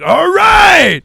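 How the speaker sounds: high, loud exultant shout; rock-crowd cheer. Retold or imitated. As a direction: cheer like the band just broke.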